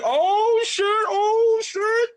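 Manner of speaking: very high, loud hyped shout; crowd going wild. Retold or imitated. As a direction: whoop like his boys in the crowd. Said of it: "They're like".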